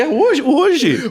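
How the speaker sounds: high-pitched, incredulous echo; hammed-up disbelief; retold surprise. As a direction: repeat it as if amazed.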